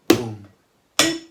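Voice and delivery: ordinary delivery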